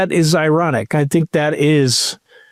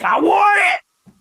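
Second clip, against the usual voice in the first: shouting. Retold or imitated. Shouting demand to waiters.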